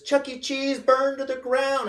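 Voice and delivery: high, loud, drawn-out mock wail; imitated crying child. Daughter tells the household, high mock-crying wail. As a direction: wail it like a devastated little kid. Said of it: "she runs off crying".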